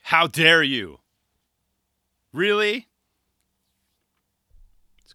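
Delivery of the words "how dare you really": mock indignant. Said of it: "I was like".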